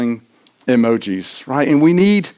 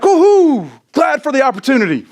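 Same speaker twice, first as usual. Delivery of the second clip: cheerful woohoo; 9 semitones higher than usual. Retold or imitated. Fan choosing to welcome the tough game, with a high eager cheer. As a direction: greet the challenge gladly. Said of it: "Instead, I should be like".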